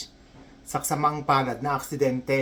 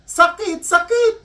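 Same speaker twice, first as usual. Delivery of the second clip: shrieky high mock yelps; crying out in pain; 5 dB louder, slower than usual. Patient repeating his complaint to the nurse, yelping in mock pain. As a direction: yelp it louder, jumping up in pitch.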